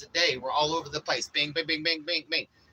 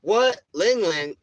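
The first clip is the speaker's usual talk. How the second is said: loud, high sudden what; startled what; 5 semitones higher, 9 dB louder, slower than usual. Real, not acted. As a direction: jump at something off camera. Sudden reaction off camera.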